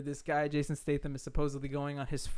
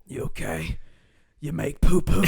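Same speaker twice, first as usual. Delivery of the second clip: gruff Jason Statham; 10 dB louder than usual. Retold or imitated. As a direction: growl it like an action hero. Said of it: "he just goes".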